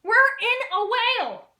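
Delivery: very high, loud, slow shriek; panicked cry. Acted. Marlin shrieking in terror inside the whale. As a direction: scream it in terror.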